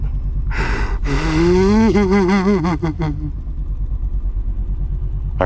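long high gasping sob; imitated sobbing gasp. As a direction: gasp like someone starting to cry. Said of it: "He began to sob".

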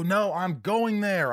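high, slow, stubborn protest; insistent refusal. Retold or imitated. Imitating grandmother insisting on visiting her husband, stubborn high protest.

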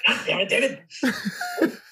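high, slow, strained Freddy rasp; slow hammed-up Freddy snarl; full Freddy voice. Shown as a demonstration. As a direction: drag it out like Freddy Krueger.

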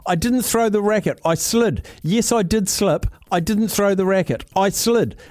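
high-pitched indignant protest to the umpire; Mira Andreeva. Retold or imitated. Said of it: "I've got what she said to the umpire".

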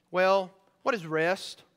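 usual speaking voice

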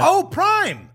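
sudden high, loud excited cry; excited shout. Real, not acted. Suddenly remembers the drink.

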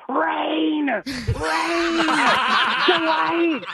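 hiss-yell a name across the street; whisper yelling. Retold or imitated. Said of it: "whisper yell for some odd reason".

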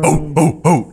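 barked cheers from an orc crowd; orc barking. Acted. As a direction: bark like cheering orcs. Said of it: "kinda barking".

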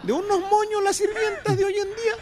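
high-pitched, fussy voice; high mock voice. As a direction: squeak it out like a fussy gossip. Mocking high voice about maids.

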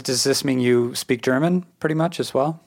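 ordinary talk, nothing marked

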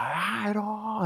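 put-on drunk friend's greeting. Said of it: "he's just like".

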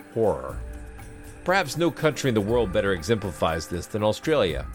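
measured narrator voice over music; documentary narration. Acted. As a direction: read it like a nature documentary. Narrated podcast intro over music.

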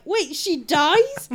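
slow, high, loud incredulous question; shocked disbelief. Retold or imitated. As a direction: stretch it out in disbelief. Shocked message, first take.

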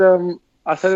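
ordinary talk, nothing marked

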